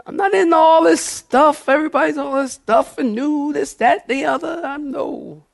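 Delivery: very high, strained preacher's protest; high impassioned sermon voice; worked-up preaching. Real, not acted. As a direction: reject it in a high preaching cry. Preaching, rejecting fads.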